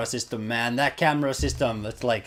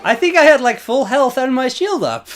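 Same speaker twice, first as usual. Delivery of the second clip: indignant protest; 13 semitones higher, 10 dB louder than usual. Real, not acted. Protesting the unfair death.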